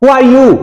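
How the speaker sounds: bright questioning lift; rising question tune. Shown as a demonstration. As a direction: pitch climbing high toward the end of the question. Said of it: "लेकिन जो interrogative रहते हैं उनमें हम".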